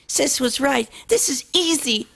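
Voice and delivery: lightly high filly voice, pleased; happy and proud of herself; young filly voice proud of herself; cheerful little filly. Acted. Noi's happy chatter.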